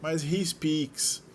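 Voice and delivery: slow "he speaks", leaning on the final S; slow stressed speaks. Shown as a demonstration. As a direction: stretch "speaks" to show the added S. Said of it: "ganhou S na terceira pessoa". ("speaks" lento, destacando o S final)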